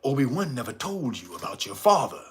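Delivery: low, fast Vader imitation; Darth Vader. Vader taunting Luke about his father, deep and menacing. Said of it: "and he said".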